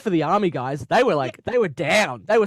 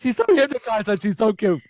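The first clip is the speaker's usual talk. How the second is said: louder, higher gushing over cuteness; 4 semitones higher, 4 dB louder than usual. Retold or imitated. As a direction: coo excitedly, like you adore her. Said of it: "I was like".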